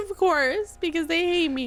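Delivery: high-pitched self-pitying whine; hammed-up mock-tearful complaint; crying overreactor. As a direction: play the wronged crybaby. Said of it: "Overreacts and cries at everything".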